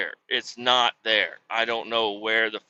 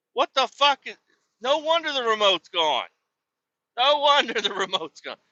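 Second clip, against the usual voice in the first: high-pitched comic exasperation; exasperated rant; 11 semitones higher than usual. Retold or imitated. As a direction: whine it in worked-up disbelief. Retold exasperated rant at kids.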